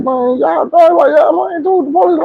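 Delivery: high, rushed, stammering panic; retold high-pitched panic; panicked. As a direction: fumble every word, scared of the cops. Panicked telling friend about police.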